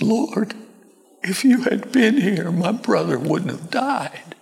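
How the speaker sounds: high, thin, grieving plea; tearful-sounding reproachless grief; different, sorrowful tone. Acted. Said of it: "probably a different tone of voice".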